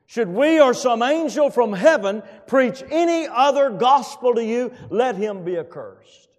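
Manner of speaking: high, loud, slowing into the curse; booming scripture proclamation; preaching scripture at full force. Acted. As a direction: pronounce the curse like judgment. Quoting Galatians with force.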